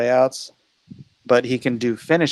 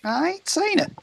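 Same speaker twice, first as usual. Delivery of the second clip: put-on Southern accent; 6 dB quieter than usual. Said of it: "Is that your Southern accent?".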